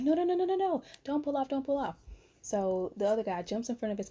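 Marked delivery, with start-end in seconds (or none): 0.0-1.9 begging the driver not to leave; frantic pleading. Retold or imitated. Pleading with the fleeing driver to stay, high and urgent.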